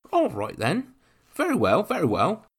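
high, loud helpful officer voice; officer offering to carry the bag, eager; eager young officer. A narrator's reading.

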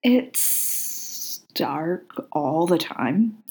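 slow, dragging, sleepy grumble; Crowley complaining on the phone, slow sleepy grumble; sleepy complaint. A narrator's reading. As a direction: grumble it out slowly, half asleep. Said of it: "Crowley complained".

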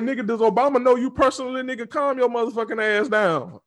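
fast, high, loud mock telling-off; retold cussing out.

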